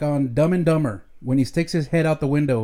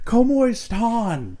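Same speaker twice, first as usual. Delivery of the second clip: mock echo; 6 semitones higher, much slower than usual. Mimics wife's greeting.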